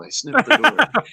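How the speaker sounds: high, loud, drawn-out catchphrase; joke catchphrase. Pretend. Player coining a new catchphrase, mock-proclaiming. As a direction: belt it out as a silly slogan.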